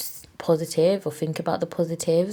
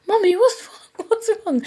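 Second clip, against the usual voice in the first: put-on child voice; 4 dB louder than usual. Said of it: "And Janelle was like".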